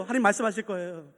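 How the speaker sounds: ordinary talk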